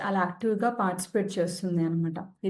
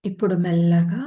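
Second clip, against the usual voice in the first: guided meditation; 4 dB louder than usual. Acted. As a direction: ease listeners into rest, one unhurried phrase at a time. Leads a yoga nidra session.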